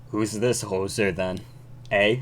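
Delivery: a hint of stock Canadian accent; attempted Canadian accent. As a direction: try a half-hearted Canadian judge. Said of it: "how to do a Canadian accent".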